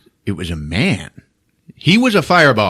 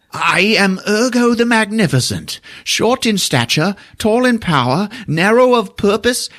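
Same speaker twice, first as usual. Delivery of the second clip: high, proclaiming mock-grand voice; pitched-up grand boast; grandiose wizard; 11 semitones higher than usual. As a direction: announce yourself like a pompous sorcerer. Grand self-introduction.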